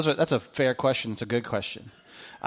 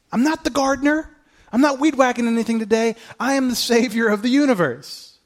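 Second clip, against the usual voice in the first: higher, proud declaration; a hint of Jesus; 8 semitones higher than usual. Pastor speaking as Jesus to Mary, proud declaration. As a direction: declare who you really are.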